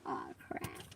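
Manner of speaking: breathy whisper. Retold or imitated. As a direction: whisper it under your breath. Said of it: "I was like".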